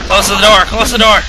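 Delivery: loud, high urgent yell; panicked shout. Real, not acted. Player fleeing the monster, shouting in panic.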